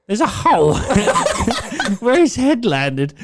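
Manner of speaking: can barely get it out for laughing. Real, not acted. Laughing while telling dad's fall.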